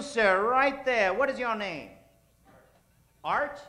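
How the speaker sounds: usual speaking voice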